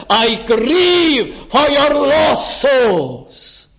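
high, drawn-out impassioned lament; anguished cry. Acted. Preacher as Paul answering Israel, crying out in grief. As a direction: cry it out slowly, each word stretched.

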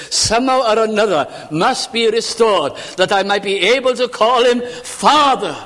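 high, insistent sermon build to a loud peak; impassioned preaching. Real, not acted. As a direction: preach it with rising fervour. Preaching at full force.

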